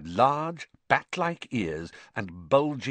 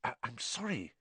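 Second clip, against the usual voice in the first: hushed, stammering apology; whispered; 2 semitones higher, 11 dB quieter than usual. A narrator's reading. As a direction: apologise very softly, halting. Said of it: "he whispered".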